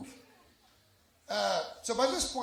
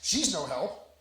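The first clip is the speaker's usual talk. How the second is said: usual speaking voice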